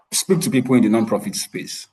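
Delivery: ordinary delivery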